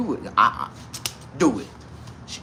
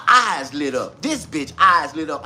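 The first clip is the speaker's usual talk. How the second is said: normal talking voice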